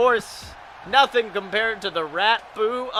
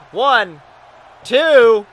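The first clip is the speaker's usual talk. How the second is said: pinfall count; 9 dB louder than usual. Acted.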